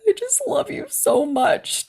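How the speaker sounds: high, slow and tearful; tearful love confession. Retold or imitated. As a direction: choke it out with happy tears. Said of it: "I cried".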